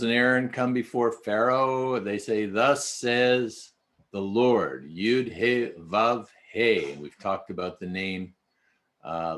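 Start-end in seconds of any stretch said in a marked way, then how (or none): 2.5-6.9 slow, solemn declaration; solemn proclamation. Acted. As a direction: proclaim it slowly like scripture. Reading God's words to Pharaoh.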